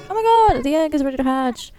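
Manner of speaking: high, loud burst of excitement; thrilled squeal; excited exclamation. Real, not acted. Egg is ready to hatch.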